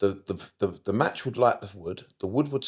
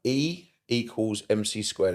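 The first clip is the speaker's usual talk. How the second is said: slow, spelled-out repeat of the formula; slower than usual. Shown as a demonstration. Repeating the formula slowly for a friend who asked. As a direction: say each letter slowly so it lands. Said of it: "No, say it one more time".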